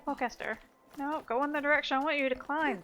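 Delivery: normal talking voice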